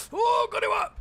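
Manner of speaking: put-on high squeaky foreign boy voice; Maxi impression. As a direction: squeak it out like an excited kid. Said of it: "good Maxi impression".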